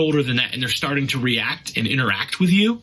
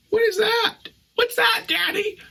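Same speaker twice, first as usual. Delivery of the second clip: put-on very high little-kid voice; high-pitched imitation of a child; curious child. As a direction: pipe up like a small kid asking Dad. Voicing his children's curious question.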